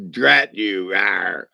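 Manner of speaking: fake-growl at him in mock defeat. To his friend on the phone, a playful fake growl of defeat.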